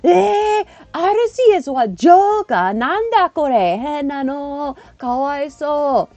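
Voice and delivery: high, drawn-out Japanese exclamations; surprised Japanese kids. Retold or imitated. Japanese pupils react to the Joker card, surprised kids. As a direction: imitate puzzled children in Japanese. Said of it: "so they're just like".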